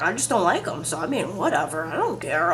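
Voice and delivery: low, offhand mock shrug; imitated dismissiveness. Imitating Tim shrugging off dislike.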